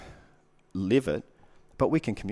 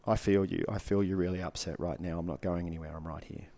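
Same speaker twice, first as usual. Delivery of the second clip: calm reassurance; 3 semitones lower than usual. Shown as a demonstration. Man to his upset partner, calm reassurance.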